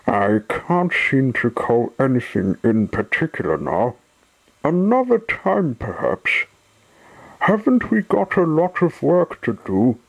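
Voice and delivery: flustered, pitch leaping high as he dodges; hurriedly steer away from the topic, high-pitched; evasive. A narrator's reading. Top Hat avoids telling Sunshine his stories, evasively. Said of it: "changing the subject".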